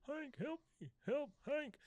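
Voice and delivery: a small, far-off, high plea for Hank; faint high cry for help. Retold or imitated. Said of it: "very faintly, you hear".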